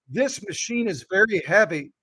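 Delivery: ordinary talk, nothing marked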